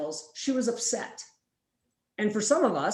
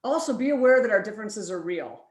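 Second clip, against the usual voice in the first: ordinary delivery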